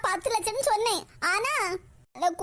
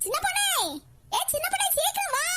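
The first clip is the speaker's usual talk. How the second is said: calling out; 6 semitones higher than usual. Acted. Calling the young girl over.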